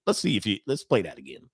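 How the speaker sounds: normal talking voice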